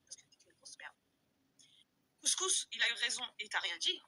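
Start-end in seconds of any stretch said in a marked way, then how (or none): 0.1-0.9 insulting whisper. Real, not acted. To the rival on her live, a contemptuous whisper.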